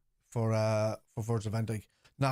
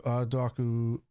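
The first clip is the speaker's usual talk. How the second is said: usual speaking voice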